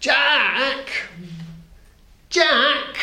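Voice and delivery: high, far-reaching calls of the name; calling. A narrator's reading. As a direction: call a daydreaming pupil back. Teacher calling Jack.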